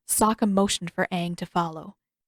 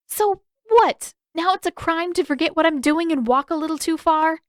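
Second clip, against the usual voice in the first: put-on much higher, louder, indignant girl voice; angry girl; 9 semitones higher, 4 dB louder than usual. A narrator's reading. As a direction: throw it back at him. Sister's angry defense.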